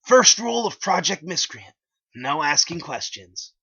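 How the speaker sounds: high, loud, slowing at the end; high smug rule recital; Liquid Chris voice. A narrator's reading. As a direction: pipe up smugly in a high voice. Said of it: "Liquid piped up with".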